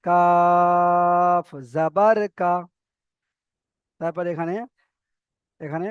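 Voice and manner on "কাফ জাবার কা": "কাফ" held long, final "কা" kept short; slow "কাফ জাবার" ending on a short "কা"; no drawl at end. Shown as a demonstration. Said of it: "উচ্চারণে একদম টান দেওয়া যাবে না".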